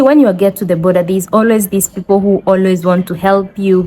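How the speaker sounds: ordinary delivery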